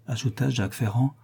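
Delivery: ordinary delivery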